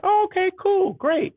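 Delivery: high, sing-song mock cheer; fake cheerful okay. Retelling the check news to the listener in a high mock-cheery voice. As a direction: chirp it way up in fake delight. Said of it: "I'm like".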